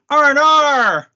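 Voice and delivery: loud high Australian call of R and R; mock Australian shout. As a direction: yell it like a cheeky Aussie. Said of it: "I go".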